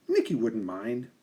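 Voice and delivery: plain reading voice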